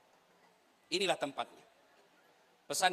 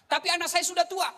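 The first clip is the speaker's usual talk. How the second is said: mock objection; 12 semitones higher, 7 dB louder than usual. Objection voiced.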